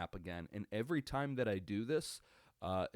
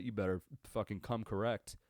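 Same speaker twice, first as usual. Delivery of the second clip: normal talking voice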